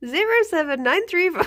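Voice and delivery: high singsong digits breaking into laughter; jokey over-helpful recital, giggling; laughing mock phone greeting. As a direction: reel off the number, cracking up. Jokingly reels off number, laughing.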